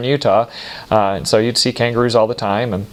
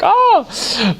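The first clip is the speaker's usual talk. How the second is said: startled yelp. Retold or imitated. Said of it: "It was just like".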